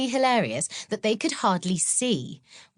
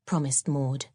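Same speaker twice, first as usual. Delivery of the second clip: ordinary delivery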